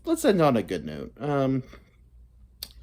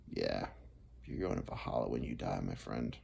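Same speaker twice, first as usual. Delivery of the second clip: a lightly lowered, soft bro voice; low mock bro voice; 14 dB quieter than usual. Retelling a stranger's Valhalla claim in a low mock bro voice. Dude's Valhalla line, retold.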